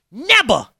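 put-on high childish outburst; little daughter refusing. Dad imitates young daughter refusing a bath, high defiant outburst. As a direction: imitate a defiant three-year-old declaring it. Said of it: "And this is what she says".

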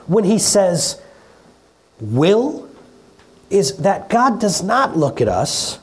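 normal talking voice

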